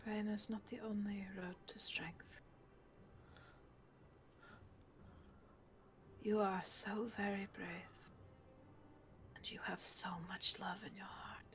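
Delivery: hushed, sincere reassurance; tender whispered comfort. Acted. Moira holds the caged girl's hand, tender whispered encouragement. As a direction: whisper it gently, leaving long pauses.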